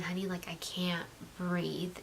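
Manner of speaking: hushed and afraid, barely breathing the words; frightened whisper. Retold or imitated. Wife whispering to her husband that she cannot breathe, frightened. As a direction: whisper it, scared, to your husband.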